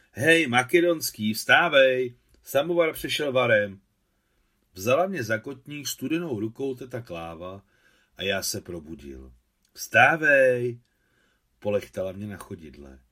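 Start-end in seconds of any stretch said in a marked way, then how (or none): 0.1-3.8 loud call to wake someone; waking call. Acted. Aunt waking the boy for tea, loud call. 9.7-10.8 waking call. Acted. Aunt wakes the boy again.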